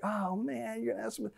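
hushed, groaning aside under the breath; embarrassed husband murmuring beside his wife at the counter. Retold or imitated. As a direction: mutter it so she can't hear.

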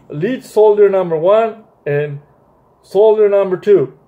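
plain reading voice

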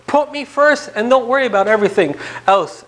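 usual speaking voice

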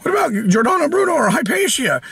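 high-pitched mocking challenge; exaggerated high voice of a heckler; mock objector. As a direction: whine it like a silly objector.

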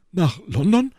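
startled exclamation of the city name; surprised cry. Acted. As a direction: call it out in disbelief. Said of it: "rief Porthos".

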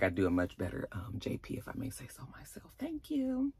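very soft, fading into whisper; hushed self-congratulation; self-satisfied aside. Real, not acted. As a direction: murmur a mock-modest boast. Mock-modest aside praising own impression.

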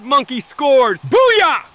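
slow build to a shout; drawn-out goal call, then erupt; long goal yell. Real, not acted. Goal scored, commentator erupts.

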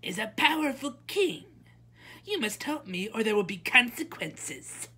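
high, loud, demanding princess; imperious princess, pitched high. A narrator's reading. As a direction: announce it like an entitled princess. Princess threatens the witch.

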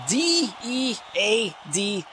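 slow, letter-by-letter D E A D; spelled out. Real, not acted. Commentator vowing revenge on the doctors, spelling it out slowly. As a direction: spell D E A D out for effect.

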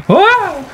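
sudden high yelp; a quick jolt of surprise; short startled cry. Real, not acted. Sudden scare mid-game.

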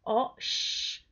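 "O, sh" in two parts, the sh held as a hush; soft sh sound. Shown as a demonstration. Teacher correcting learners after a wrong example, isolated hushing "sh". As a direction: break off "O", then a drawn-out soft "sh". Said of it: "un sonido más suavecito".

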